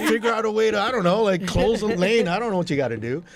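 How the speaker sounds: high, loud mock exasperation; retold protest. Promoter telling blamed-on-him traffic to sort itself out, exasperated protest.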